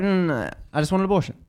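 impatient brush-off. Retold or imitated. Patient cutting off Abby's adoption pitch, impatient. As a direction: rattle it off dismissively.

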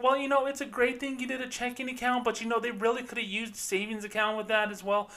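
mock-friendly banker talk; bank employee voice. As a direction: put on the polite banker pitch. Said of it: "the way Bank of America typically talks".

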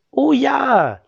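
high, loud delighted cry; excited chorus. Acted. Neighbours cheer about the yogurt: high, loud delight. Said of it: "sagten alle".

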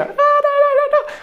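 não não bem agudo, imitação sorridente; imitando cheerleader. Retold or imitated. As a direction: imita a cheerleader com voz fina. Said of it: "Todas as vezes que elas ficam". (imitando uma cheerleader em falsete)

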